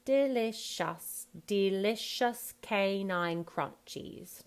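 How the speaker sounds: slow, regular British delivery; drawn-out British jingle line; typical British accent. Shown as a demonstration. Coach offering the actor an option, typical British accent. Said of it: "like, typical British".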